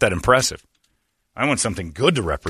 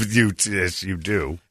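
usual speaking voice

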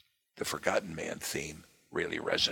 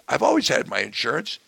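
loud, higher, complaining; aggrieved voter; 8 semitones higher, 7 dB louder than usual. Retold or imitated. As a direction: voice an angry taxpayer. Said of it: "The other guy says".

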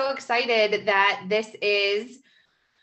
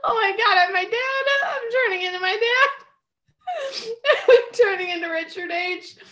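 high squealing laugh-talk; laughing while talking; 11 semitones higher than usual. Real, not acted. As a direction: crack up through the words. Laughing at herself.

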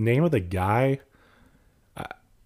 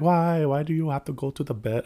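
higher-pitched, badly faked Russian accent; 7 semitones higher than usual. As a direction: imitate a Russian woman with a shaky grasp of the accent. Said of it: "That's not even a Russian accent".

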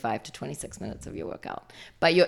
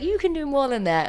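teasing push; 11 semitones higher than usual. Retold or imitated. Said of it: "like, giving them grief".

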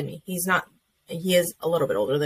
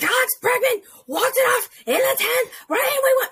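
imitating husband's high, loud, overjoyed cry; husband yelling about the tenth-inning win; excited husband; 4 dB louder than usual. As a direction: crow it like he just saw the walk-off. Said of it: "It's like".

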